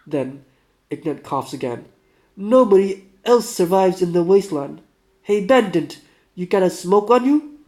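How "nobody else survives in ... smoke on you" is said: put-on loud, higher-pitched Russian accent. Sick man asking a bandit for a smoke, loud Russian accent. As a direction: call out to the stranger in a Russian accent. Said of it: "do my best, uh, Russian".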